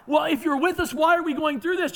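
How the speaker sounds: high, loud whining challenge; preacher mimicking Gideon's protest; mock complaint. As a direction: throw the doubt back at God.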